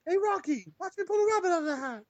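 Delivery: high-pitched cartoon impression; goofy cartoon character voice. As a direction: put on a silly high cartoon voice to call your pal. Acts out famous cartoon catchphrase.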